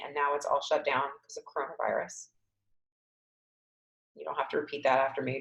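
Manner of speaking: normal talking voice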